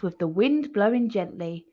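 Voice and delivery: usual speaking voice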